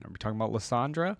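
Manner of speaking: usual speaking voice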